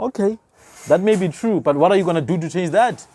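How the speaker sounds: usual speaking voice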